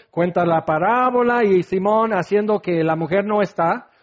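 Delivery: ordinary talk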